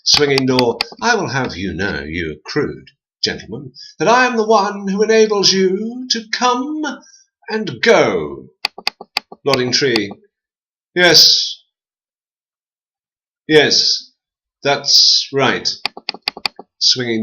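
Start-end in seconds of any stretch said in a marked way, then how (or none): 1.0-8.5 haughty aristocratic door voice; Swinging Door rebuking the man, lightly haughty; lightly posh door. A narrator's reading. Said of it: "aristocratic clown". 10.9-15.7 higher, louder tree agreeing; Nodding Tree backing the door, high and louder. A narrator's reading.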